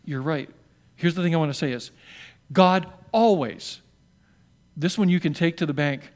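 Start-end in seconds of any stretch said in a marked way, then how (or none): 2.5-3.8 slow, drawn-out stress on always; emphatic. Acted. Pastor assuring the congregation, emphatic slow. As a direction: lean hard into always.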